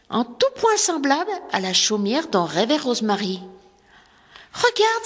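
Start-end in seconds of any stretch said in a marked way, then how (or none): 4.5-5.0 put-on much higher, eager call of a little girl; excited cry. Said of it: "s'écria celle-ci". (petite fille qui s'écrie, ravie, beaucoup plus aigu)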